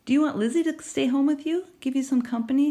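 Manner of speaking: a hint of a worried mother asking; mother's gentle offer. A narrator's reading. Mother asking, voiced higher.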